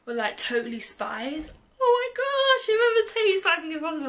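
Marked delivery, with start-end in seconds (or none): 1.8-4.1 high, loud, fast squeal of delight; excited outburst. Real, not acted. Remembering Totally Spies for viewers, a loud excited squeal. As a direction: burst out, thrilled at remembering the cartoons. Sudden nostalgic cartoon outburst.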